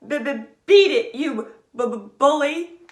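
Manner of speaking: stammering, loud nervous threat; scared glider sputtering at the bully; sputtered. Acted. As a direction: stutter through it bravely. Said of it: "Bismarck sputtered".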